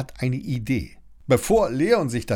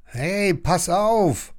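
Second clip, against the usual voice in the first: warning; 8 semitones higher, 3 dB louder, much slower than usual. A narrator's reading. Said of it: "warnt Lilli".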